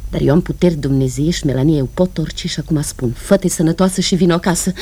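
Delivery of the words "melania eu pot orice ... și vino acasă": normal talking voice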